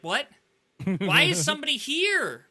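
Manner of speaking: loud, high startled yelp; shocked outburst. Retold or imitated. Teammate shocked by an enemy out of nowhere, loud and startled. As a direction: blurt it out, caught completely off guard. Sudden ambush in game.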